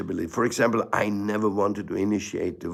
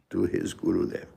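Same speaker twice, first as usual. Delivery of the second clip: ordinary delivery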